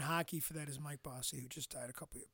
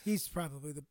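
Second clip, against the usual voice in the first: normal talking voice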